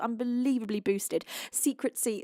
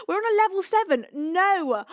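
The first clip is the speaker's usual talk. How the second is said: high, loud, fast rush of disbelief; excited disbelief; 8 semitones higher, 7 dB louder than usual. Real, not acted. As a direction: blurt it out as the level jumps. Viewer level jumps, streamer reacts.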